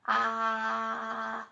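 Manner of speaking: loud held ahh; saying ahh. Shown as a demonstration. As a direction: open vowel ahh held out. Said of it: "I want you to say".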